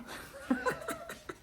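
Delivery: high breathless unvoiced giggle; airy giggle. Real, not acted. Streamer laughing at finding eighty degrees cold, high giggle. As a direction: giggle at yourself.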